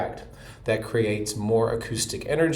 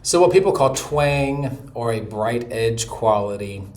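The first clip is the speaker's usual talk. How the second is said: normal talking voice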